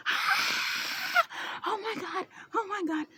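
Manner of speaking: high, frantic mock panic, over and over; panicked mom. Daughter mimics her panicking mother. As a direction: imitate mom losing it.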